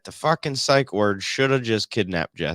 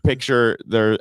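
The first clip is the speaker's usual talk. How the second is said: ordinary talk, nothing marked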